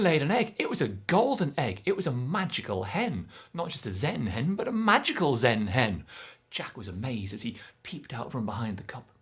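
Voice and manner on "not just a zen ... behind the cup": ordinary delivery, nothing marked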